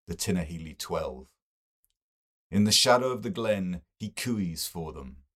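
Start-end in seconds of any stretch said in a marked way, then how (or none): none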